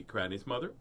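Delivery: plain reading voice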